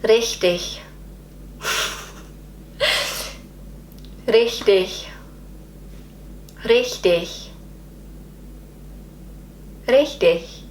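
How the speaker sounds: careful, separate learner's "Richtig"; attempted German pronunciation. Shown as a demonstration. Learner copying husband and daughter, careful attempt. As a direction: practise "Richtig" as an isolated German word. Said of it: "word I have been struggling to pronounce".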